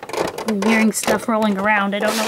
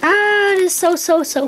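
high squeal settling into gushing; squeal, then gush in real delight; excited, starting high and coming down; thrilled; 7 semitones higher than usual. Real, not acted. Gushing over the new trailer.

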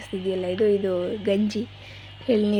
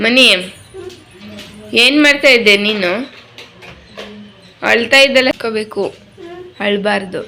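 loud coaxing; 3 semitones higher, 9 dB louder than usual. Real, not acted. Mother loudly coaxing her son about crying at school.

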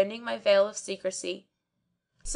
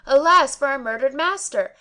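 louder, higher report of the crowd's cry; quoted outcry; 5 semitones higher, 6 dB louder than usual. Retold or imitated. Servant reporting the crowd's cry to the Kazi. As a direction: repeat the crowd's wail. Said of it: "all crying out".

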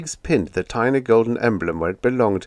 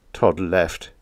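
usual speaking voice

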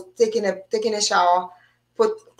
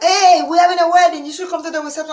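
high, excited mock neighbor call; calling out invitation; 7 semitones higher, 4 dB louder than usual. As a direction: call up to someone on a patio, pitched high. Neighbors calling up to her patio.

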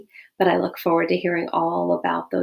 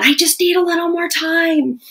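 much higher, louder, exasperated whine; fighting tone; 10 semitones higher, 4 dB louder than usual. Shown as a demonstration. Parent snapping at kids before dinner, much higher and exasperated. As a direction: let the stress spill out at them. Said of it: "rather than".

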